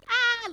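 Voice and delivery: high, loud comic cry; cartoony falling scream. Old lady falls from the church, comical high scream. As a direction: scream like a cartoon character dropping off a roof. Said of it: "falling in this cartoony, comical way".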